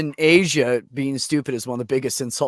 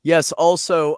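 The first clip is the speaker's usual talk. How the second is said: normal talking voice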